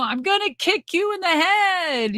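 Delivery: mock warning yell. Wrestler warning a partner so they clue up, mock warning yell. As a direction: sing out a warning in a high held voice. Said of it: "just warning him or something".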